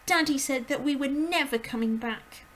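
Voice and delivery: put-on high distressed little girl; breathless girl's cry. A narrator's reading. Matilda's voice.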